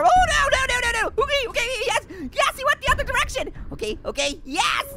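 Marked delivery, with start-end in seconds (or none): 0.0-3.0 high loud panicked no's. Real, not acted. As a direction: panic as he closes in. Chaser closing in.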